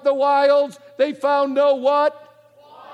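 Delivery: ordinary delivery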